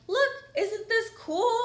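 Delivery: high, slow, gushing show-off; doctor proudly showing off the placenta, high and excited. Retold or imitated.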